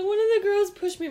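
put-on high, loud little-kid whine; mock a child telling on someone; tattling hurt child. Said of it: "She's like".

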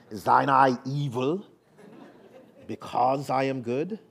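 put-on British accent on an old Bible verse. Shown as a demonstration. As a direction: quote it in a mock-proper British accent. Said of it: "a British accent to say that".

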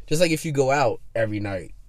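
usual speaking voice

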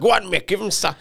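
high, loud pub-crowd roar of encouragement; mock crowd cheering. Friend retelling how the room cheered at the telly, high loud cheering. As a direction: cheer the dancer on as if it were live.

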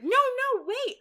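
high, loud, urgent no no wait; excited high protest. Retold or imitated. Mary Margaret panics at David's family dinner remark, squealing high. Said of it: "Mary Margaret's like".

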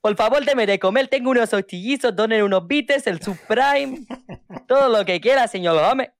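put-on high, singsong shopkeeper accent; acento de Apu. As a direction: play Apu behind the counter. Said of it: "Con mis amigos parezco, eh, Apu". (acento de Apu, agudo)

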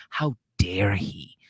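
whispered, slow "How dare he?"; mock outraged whisper. Mocking the offended fiancée, slow whispered outrage. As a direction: breathe it like scandalised gossip.

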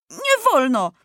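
put-on high boyish objection; stubborn insistence. A narrator's reading. Maciuś contradicting the officer, stubborn. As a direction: dig in, voice pitched high. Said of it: "znów upierał się Maciuś".

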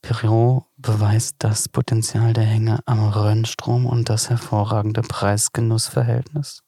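low, slow catalogue read; wine ad read. Acted. Third take of wine text.

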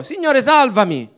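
high, loud cry for rescue; cry for help. Acted. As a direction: cry out to be saved as you sink. Peter cries for help. (grido disperato di Pietro, più alto e forte)

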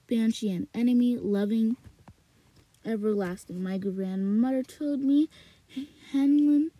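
ordinary delivery, nothing marked

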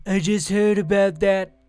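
loud, put-on presidential impersonation; Trump voice. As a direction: belt it out as a mock president. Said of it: "read this in my Trump voice".

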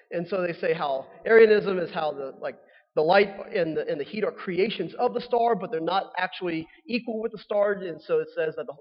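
usual speaking voice

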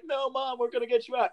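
high, hammed-up rescue cry; mock heroic plea. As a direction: beg mom to come along in mock earnest. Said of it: "And they're like".

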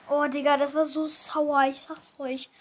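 usual speaking voice

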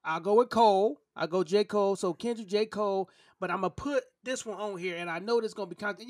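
ordinary talk

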